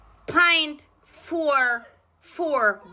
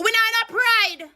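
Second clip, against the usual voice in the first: angry outburst; 10 semitones higher, 5 dB louder than usual. Real, not acted. Ranting at a minister on TV.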